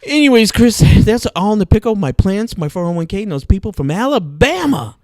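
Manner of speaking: loud, high, theatrical rant; dramatic outburst. Real, not acted. To Chris on the podcast, a loud dramatic rant. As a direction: declare it like a big dramatic complaint.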